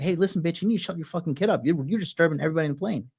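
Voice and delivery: rapid, lightly voiced stern flight attendant telling off a passenger; imitating a flight attendant scolding a mother, rapid and clipped; mock flight attendant. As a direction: rattle it off fast like a fed-up flight attendant. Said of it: "they rush over and like".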